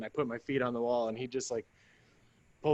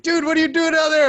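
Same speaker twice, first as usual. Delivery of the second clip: much higher, loud disbelieving call; incredulous yell; 11 dB louder than usual. Retold or imitated. Medic calling down into the pit, incredulous yell. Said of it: "He's like".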